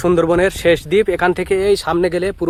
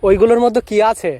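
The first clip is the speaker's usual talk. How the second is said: loud, higher, repeating to a deaf man; to someone hard of hearing; 4 semitones higher, 4 dB louder than usual. Real, not acted. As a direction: call out again so he hears. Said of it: "মানে কম শোনে আর কি".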